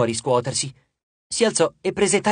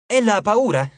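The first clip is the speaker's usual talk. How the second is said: Tyson blurting out his worry, a bit higher; eager, childlike cry of concern; excited exclaim; 4 semitones higher, 4 dB louder than usual. A narrator's reading. Tyson asks his friends worriedly about Ella, eager exclaim. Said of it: "esclamò".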